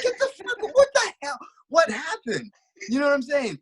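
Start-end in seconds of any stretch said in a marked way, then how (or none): 0.0-2.5 very high, incredulous squeal; shocked disbelief. Retold or imitated. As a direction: relive it, half laughing in disbelief. Retold shock at knockout.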